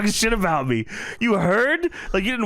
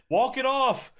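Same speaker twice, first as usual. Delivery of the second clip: imitated heckler yell; 8 semitones higher, slower than usual. Someone from the crowd jeering the wrestler, imitated yell. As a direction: toss it out high like a heckler. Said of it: "someone from the crowd yells".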